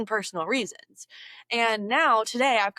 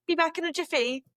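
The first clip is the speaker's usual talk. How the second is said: put-on higher-pitched, half-British, half-Aussie accent; British-Australian accent; 7 semitones higher, faster than usual. Vlogger dashing to the oven, in a British-Australian accent. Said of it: "between a British and Australian accent".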